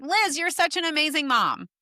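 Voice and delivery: high, sugary mock compliment; mock gushing praise. Internet stranger flattering a mom, mock gushing. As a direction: gush it like an empty online compliment.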